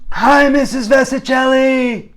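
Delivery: put-on high, loud, drawn-out kid greeting; excited greeting yell. Boys surprising their favorite teacher from behind, shouted greeting. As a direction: holler hello like an eager schoolboy.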